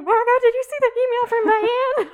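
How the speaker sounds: high, loud, frantic voice; panicked roommate. Retold or imitated. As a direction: squeal it in alarm. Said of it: "So Elizabeth was like".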